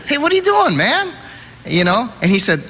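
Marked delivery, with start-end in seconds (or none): 0.1-1.1 high-pitched, incredulous cry; alarmed protest. Retold or imitated. Dreamer to his buddy holding the rope, alarmed protest. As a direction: act out startled disbelief at a friend.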